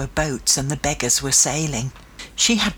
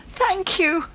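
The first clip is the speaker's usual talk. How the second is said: high-pitched grateful exclamation; tearful blurt; 14 semitones higher than usual. A narrator's reading. Child overcome with relief thanks the rescuer, tearful blurt. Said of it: "all that I could do to blurt out".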